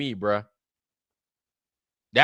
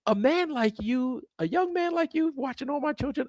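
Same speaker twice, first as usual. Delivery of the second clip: Susan Lucci voice; 12 semitones higher, 3 dB quieter than usual. Retold or imitated. Susan Lucci teasing a young fan, in a high put-on voice. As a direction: play her as a surprised, teasing soap star. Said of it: "She was like".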